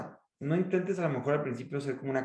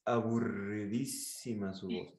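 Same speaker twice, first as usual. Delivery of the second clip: low, soft, slow drone; boring voice; 6 semitones lower, 7 dB quieter, slower than usual. Shown as a demonstration. Coach imitating tedious conference speakers, boring drone. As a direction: drag it out in a dull monotone. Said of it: "porque hablan así".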